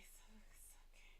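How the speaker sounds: soft slow whisper forcing calm; slow tight whisper. Retold or imitated. To herself in the slow coffee line, strained whisper. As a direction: whisper it slowly, teeth clenched. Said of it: "It was like".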